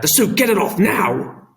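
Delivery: loud high urgent command; urgent order. A narrator's reading. Father ordering his son urgently. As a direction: order him out of the suit now. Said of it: "snapped Dad".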